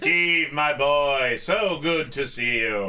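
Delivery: loud, booming welcome; hearty host greeting. Acted. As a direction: greet him like an old friend. GM voicing robot host greeting.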